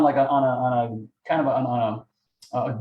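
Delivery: usual speaking voice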